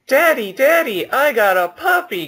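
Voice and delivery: put-on very high, loud child voice; excited little kid. Child asking Daddy about a puppy, excited kid voice. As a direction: plead like an over-eager child.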